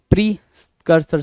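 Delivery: Precursor split slowly, PRI, then CURSOR; slow sounding-out. Shown as a demonstration. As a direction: pause after "pri" in precursor. Teacher breaks word after spelling. (Precursor को "pre" पर रुककर, धीरे बोलते हुए)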